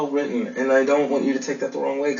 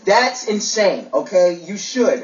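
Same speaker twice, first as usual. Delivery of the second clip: raised, higher voice, exasperated; indignant; 6 semitones higher, 4 dB louder, slower than usual. Acted. Man to date who ignores his race, indignant. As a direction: push back hard, pitched up and louder. Heated lovers' quarrel over race.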